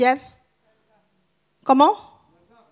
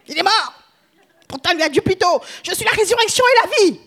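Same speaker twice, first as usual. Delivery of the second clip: incredulous; 6 semitones higher than usual. Acted. Martha's doubting objection voiced.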